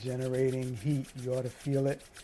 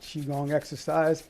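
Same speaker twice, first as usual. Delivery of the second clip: ordinary talk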